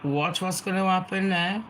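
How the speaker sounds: normal talking voice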